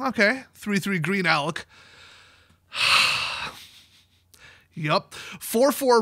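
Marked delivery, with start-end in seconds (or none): 2.7-3.5 long, voiceless sigh. Real, not acted. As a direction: let out an audible breath. Sighs while reading the token list.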